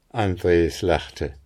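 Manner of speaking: plain reading voice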